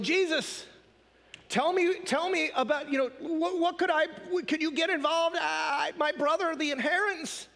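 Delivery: high, halting, stumbling appeal; flustered mock plea. Man in the crowd asking Jesus for help, flustered high plea. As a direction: stammer it out high, hunting for words. Acting the man's stammering plea.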